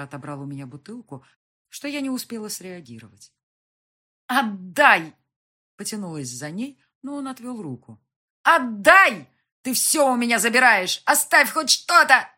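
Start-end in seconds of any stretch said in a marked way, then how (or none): 4.3-5.2 loud, high angry demand; angry shout. A narrator's reading. Wife demanding the bottle back, angry shout. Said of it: "Закричала я зло". 8.4-12.3 very loud, high, furious cry; angry scream. A narrator's reading. Wife fighting him for the bottle, screaming angrily. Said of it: "Закричала я зло".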